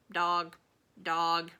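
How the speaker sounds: a hint of soft parrot croak; quiet croak. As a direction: mutter it like a sleepy parrot. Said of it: "croaking real quiet to herself".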